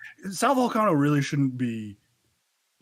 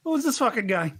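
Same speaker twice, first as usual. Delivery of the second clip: mock Bill Burr; 11 semitones higher, 5 dB louder than usual. Joining the Bill Burr impression.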